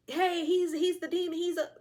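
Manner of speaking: loud, high-pitched finger-pointing; hammed-up public denouncing; mock accusation. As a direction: call out the culprit in alarm. Acted victim accusing the villain.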